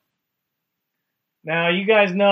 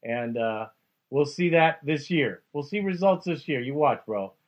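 usual speaking voice

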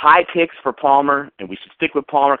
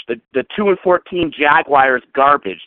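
ordinary talk

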